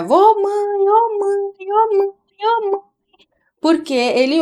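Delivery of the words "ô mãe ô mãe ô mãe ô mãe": put-on high, repetitive child calling "Ô, mãe"; mother imitating her son's repeated call, higher voice. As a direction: copy the little boy calling his mom over and over. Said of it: "e ele fica".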